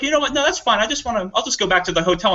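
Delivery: high, polite giving up; mock resigned. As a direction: play the gracious refusal. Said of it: "He's like".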